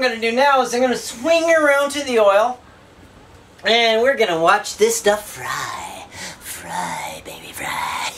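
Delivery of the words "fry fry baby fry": unvoiced whisper; whispered cheer. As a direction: whisper it to the pan. Cheering on the frying food.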